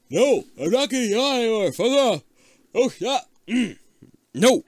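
the mummy cursing a yawn, high and loud; frantic mummy. Acted. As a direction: fight off the yawn in a high panic. Mummy refuses to yawn, voiced.

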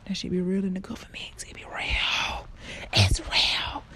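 hushed secret-telling whisper; conspiratorial whisper. Pretend.

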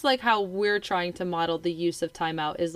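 usual speaking voice

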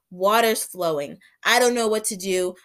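ordinary talk, nothing marked

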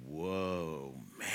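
a lightly voiced low, awed "whoa"; mock amazement, low and drawn out; awed Adam. Preacher voicing Adam seeing Eve, mock awe.

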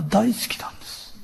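mock whisper. Comic confession about loving school.

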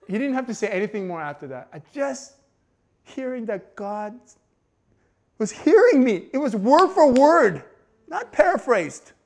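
loud, high emotional outburst; preaching fervour, near tears; overwhelmed. Real, not acted. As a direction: let the feeling burst out loud. Preacher recalls overwhelming moment.

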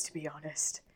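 unvoiced whisper to be honest. Real, not acted. Cohost trailing off in a whisper. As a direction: murmur it under the breath. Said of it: "whispered closing aside".

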